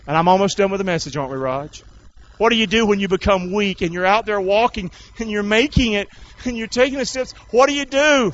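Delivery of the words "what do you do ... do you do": rising, forceful rhetorical question; building preaching. Real, not acted. Preacher asks the weakened walkers, building preaching. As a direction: build in pitch toward a shouted question.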